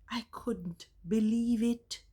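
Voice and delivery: breathless whispered disbelief; stunned whisper. Real, not acted. Vlogger recalling her shock at the class, whispered disbelief. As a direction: hush it, still astonished. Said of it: "I was stunned".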